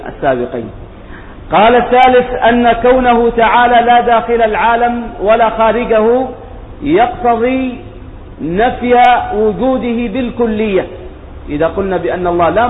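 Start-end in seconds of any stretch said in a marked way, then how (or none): 1.5-10.9 raised, projected voice for reading out a text; formal recitation of a scholarly text; reading text aloud. Real, not acted. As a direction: read it out formally to a class, loudly.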